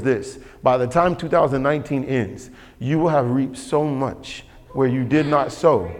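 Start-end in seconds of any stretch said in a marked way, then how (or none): none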